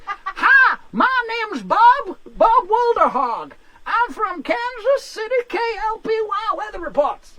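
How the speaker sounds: hammed-up reporter shouting his intro over the wind; American storm reporter. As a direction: reporter tied to a pole, announcing himself. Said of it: "and he goes".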